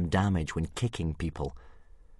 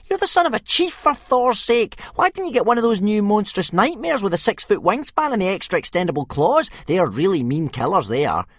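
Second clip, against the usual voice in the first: very high, loud mocking boy's voice; bully crowing at Hiccup about dragons, very high; 9 dB louder than usual. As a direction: crow it at him, high and loud. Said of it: "crowed Speedy Fist".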